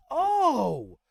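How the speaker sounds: high, loud, grand character voice; Tyrael recognizing him. Acted. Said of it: "As Tyrael looks to him and says".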